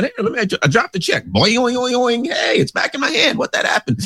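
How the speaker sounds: high squeaky play-acting with boing noises; goofy cartoon voice. Host mocks the bouncing check in a silly high voice. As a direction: ham it up like a bouncing toy.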